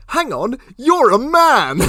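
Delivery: high, slow, loud shocked cry. Retold or imitated. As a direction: squeal it out in disbelief. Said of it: "one of the options is".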